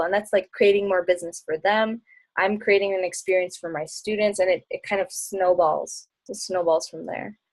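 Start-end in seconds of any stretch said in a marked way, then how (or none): none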